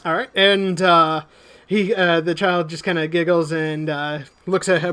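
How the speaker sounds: ordinary talk, nothing marked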